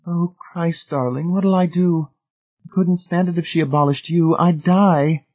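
high, tearful desperate plea. A narrator's reading. Marcia weeping for Jack, high tearful plea. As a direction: fight back tears as you beg. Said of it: "tears filled her eyes".

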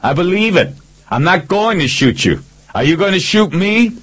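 high, urgent shouted exchange; yelling back. A narrator's reading. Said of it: "I yelled back".

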